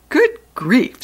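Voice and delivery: fed-up sigh of a word; teacher annoyed at the mess; very unhappy. Acted. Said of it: "in a very unhappy voice".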